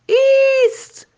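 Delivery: very high, loud, drawn-out slow command; mighty roar. A narrator's reading. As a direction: roar the word to stop everyone. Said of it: "با تمام توانش بلند غرید".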